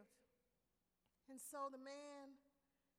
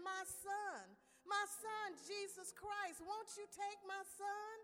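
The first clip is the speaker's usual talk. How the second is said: loud, high, slowed pleading; God urging the audience to accept Jesus, loud pleading; 6 semitones higher, 5 dB louder than usual. Acted. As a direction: beg them to take the son. God pleading, voiced by preacher.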